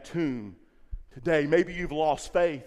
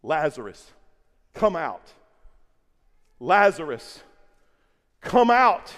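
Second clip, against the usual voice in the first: building loud call; 3 semitones lower, 4 dB louder, slower than usual. Retold or imitated. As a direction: call Lazarus out, louder each time. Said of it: "in a loud voice".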